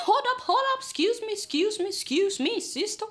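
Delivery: high, insistent protest; repeated high-pitched "excuse me" objection; retold interruption. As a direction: imitate a friend butting in. Friend interrupts her in retold argument.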